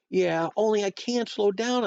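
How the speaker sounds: put-on high, worked-up kid; too excited to calm down; excited explaining. A narrator's reading. Excited child explaining to dad.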